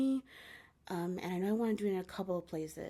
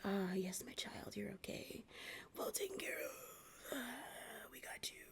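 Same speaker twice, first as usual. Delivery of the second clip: soft unvoiced whisper, much softer; soothing guide whisper; 2 semitones higher, 8 dB quieter than usual. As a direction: whisper it tenderly, reassuring a child. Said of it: "So they'd be like".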